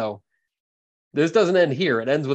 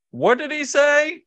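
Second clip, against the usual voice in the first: yelling from the swamp; 12 semitones higher, 5 dB louder than usual. Acted. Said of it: "Ricky just yells from the swamp".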